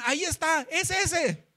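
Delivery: high-pitched cry of discovery; excited pointing-out. Acted. Searchers spot the hiding Mefiboset, excited cry. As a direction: call it out as if spotting a fugitive.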